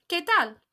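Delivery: "¿Qué tal?" a little high, falling on tal; repeated model for the class; model for repeating. Shown as a demonstration. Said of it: "Repeat after me".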